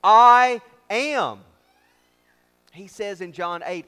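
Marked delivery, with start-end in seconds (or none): 0.0-1.4 "I am" hit very loud and high, each word set apart; booming stress on both words of "I am"; emphatic I AM. Shown as a demonstration. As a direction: proclaim "I am" with full emphatic force. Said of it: "in a way that is emphatic".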